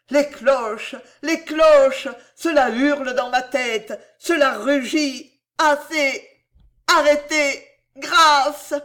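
high, loud cries of torment; dreadful wail. A narrator's reading. Tormented king with bells howling in his head, wailing. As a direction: build to a desperate plea for mercy. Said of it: "se termina par une plainte affreuse".

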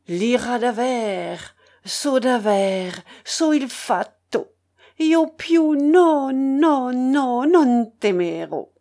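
operatic declamation, drawn out and swelling loud on the held notes; an old singer launching a tragic line with pathos; quavering pathetic declamation. A narrator's reading. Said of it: "d'une voix tremblotante, enrouée mais toujours pathétique, lança".

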